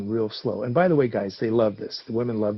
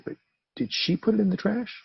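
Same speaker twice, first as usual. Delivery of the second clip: ordinary talk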